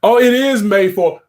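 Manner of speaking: ordinary talk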